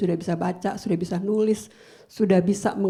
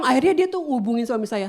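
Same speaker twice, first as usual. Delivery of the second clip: usual speaking voice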